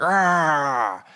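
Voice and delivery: put-on short monster roar to frighten a child; jump-scare shout in the face; scary mask scream. Daycare worker frightening a misbehaving kid, mock monster shout. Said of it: "put on, like, a scary mask".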